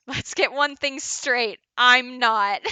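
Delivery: hammed-up indignation. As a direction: build to a big emphatic outburst. Acted retort breaking into laugh.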